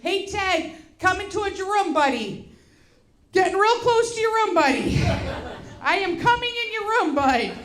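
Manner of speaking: high singsong call down the hall; calling out a warning. Retold or imitated. Parents approaching son's room, high warning call.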